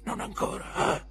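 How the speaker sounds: sussurro ironico; bisbiglio beffardo. A narrator's reading. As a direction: ripete sottovoce, schernendo. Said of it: "ripeté mister Creakle". (Creakle ripete beffardo in un bisbiglio)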